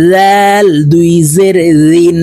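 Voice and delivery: লাল and দিন begun with dh from the tongue tip; tongue-tip Dhal. Shown as a demonstration. Teacher drilling the letter, tongue-tip dh in লাল and দিন. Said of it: "জিহ্বার আগা থেকে এই জাল".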